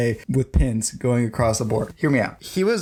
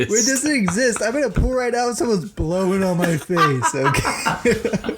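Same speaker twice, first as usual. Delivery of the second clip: mock conspiracy nut; 10 semitones higher than usual. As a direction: proclaim it like a crackpot. Goofy conspiracy voice continues.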